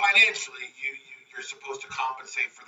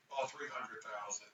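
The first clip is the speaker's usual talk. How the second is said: softer, lower, sheepish; sheepish admission; 4 semitones lower, 14 dB quieter than usual. Retold or imitated. Builder admitting the extra loan to Pete, softer sheepish. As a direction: mumble the awful number.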